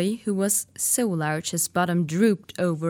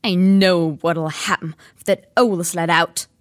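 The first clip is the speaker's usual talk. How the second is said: louder stern uncle; 8 dB louder than usual. Acted. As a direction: lay down the law. Uncle Vernon speaking.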